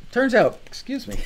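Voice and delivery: talking through a burp; words pushed out on a burp. Real, not acted. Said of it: "that was a Rockstar burp for you".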